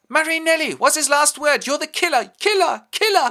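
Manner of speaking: high, loud furious shriek; mother denouncing Marinelli as killer; shrieking accusation. Acted. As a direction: scream it at the murderer.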